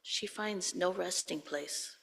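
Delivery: normal talking voice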